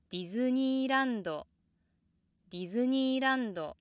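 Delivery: Japanese Disneyland, quick rise, long high plateau, drop at the end; slow, loud Disneyland held high until the downstep; low start, immediately high. Shown as a demonstration. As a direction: climb at once in Disneyland, stay level, step down late. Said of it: "start with the low pitch and go immediately high".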